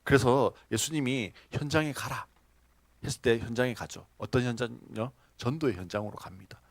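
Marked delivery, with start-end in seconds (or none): none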